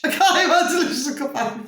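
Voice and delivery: fast, high giggly; chuckling affectionately; laughing. Real, not acted. Laughing sign-off.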